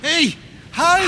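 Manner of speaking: shout. Acted. Interrupting the experiment.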